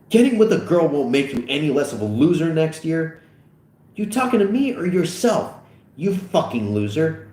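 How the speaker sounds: high, heated insults; angry taunt. Acted. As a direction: throw the insult back at him. Acted quarrel before shoving.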